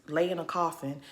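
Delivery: normal talking voice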